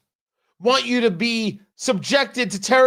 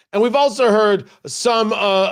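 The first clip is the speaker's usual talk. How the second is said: ordinary delivery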